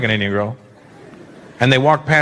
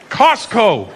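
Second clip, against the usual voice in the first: high, mock-panicked blurt; acted flustered reply, pitched up; panicked answer; 4 semitones higher than usual. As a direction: blurt any answer under interrogation. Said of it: "I panicked".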